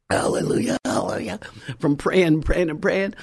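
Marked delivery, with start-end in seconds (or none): 0.1-1.4 low, croaking praise; hammed-up froggy hoarseness; hoarse as a frog. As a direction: rasp it with a worn-out voice. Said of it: "my voice was".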